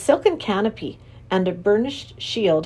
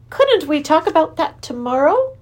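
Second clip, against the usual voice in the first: uneasy king voice; 8 semitones higher, 5 dB louder than usual. Acted. King looked uncomfortable.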